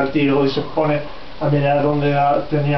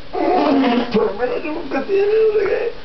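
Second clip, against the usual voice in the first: very high pitch; slower than usual. Acted. Crowd voiced in high voice.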